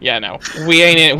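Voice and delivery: usual speaking voice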